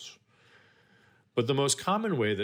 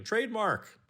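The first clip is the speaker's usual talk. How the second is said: high-pitched mock shout; 13 semitones higher than usual. Someone claiming a clever saying as a trademark, mock shouting. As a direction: call it out high, without real volume. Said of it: "followed by loudly shouting".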